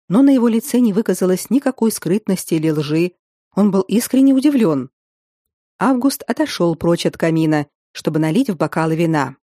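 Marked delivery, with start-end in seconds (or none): none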